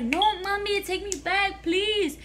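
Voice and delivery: high-pitched mock begging; dramatic retold plea. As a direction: imitate a dramatic kid imploring mom. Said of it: "little thirteen-year-old, you know, dramatic".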